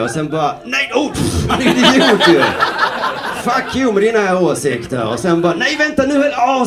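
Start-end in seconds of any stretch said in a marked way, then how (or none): 0.6-5.1 high, loud mock outrage; indignant dismissal. Debate viewer abruptly switching sides, mock indignant outburst. Acted reversal while watching debate. 5.5-6.6 high-pitched mock realisation; sudden second thoughts. Debate viewer won over by the other side, comically startled.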